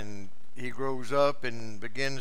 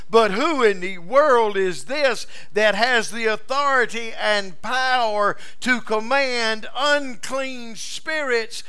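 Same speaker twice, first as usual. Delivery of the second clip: louder, higher, building sermon cadence; fiery preaching; 6 semitones higher, 5 dB louder than usual. Acted. Preacher rousing the congregation about Jesus' authority, rising fervor. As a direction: build it up with full preaching fire.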